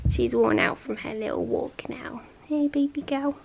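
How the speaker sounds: hushed whisper to sleeping dog. Real, not acted. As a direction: whisper so you don't wake her. Dog asleep, speaking softly.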